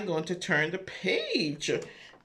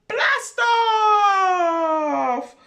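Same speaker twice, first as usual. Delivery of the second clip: high, loud excited shout; 11 semitones higher, 9 dB louder than usual. Acted. Reader to children, shouting the rocket launch. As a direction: launch the rocket with a big cheer.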